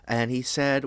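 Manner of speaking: usual speaking voice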